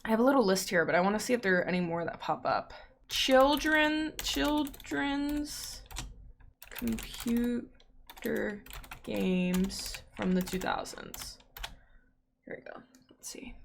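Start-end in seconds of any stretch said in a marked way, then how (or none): 3.1-11.4 drawn-out, softer title read; slow dramatic reading. Acted. 12.4-13.5 soft whisper, reading to herself. Real, not acted. Whispering while reading list.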